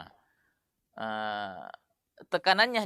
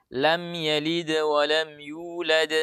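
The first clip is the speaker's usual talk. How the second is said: slow chanted recitation; Quran recitation; much slower than usual. Shown as a demonstration. Teacher reciting a verse as example. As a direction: recite calmly and slowly.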